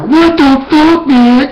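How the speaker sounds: high, loud, slow outraged shriek; hammed-up furious cry at the driver; mock outraged yell. Imitating friend's outburst in car.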